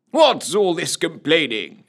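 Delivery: high-pitched jovial officer's voice; blustering captain. A narrator's reading. Captain inspecting his motley troop, jovially blustering.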